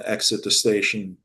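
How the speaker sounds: ordinary talk, nothing marked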